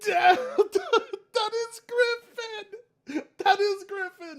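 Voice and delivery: very high, helpless laughing shriek; laughing squeal. Real, not acted. Streamer recognises Griffin in the clip, shrieking with laughter. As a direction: squeal it through real laughter.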